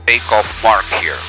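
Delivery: normal talking voice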